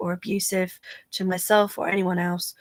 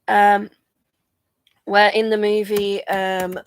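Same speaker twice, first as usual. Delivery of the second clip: ordinary talk, nothing marked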